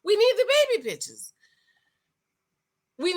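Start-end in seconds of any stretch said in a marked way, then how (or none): 0.0-1.3 high, fast demand; insistent demand. Real, not acted. Vlogger demanding proof from Justin, high insistent demand. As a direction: press the point hard and quick. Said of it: "I'm sorry".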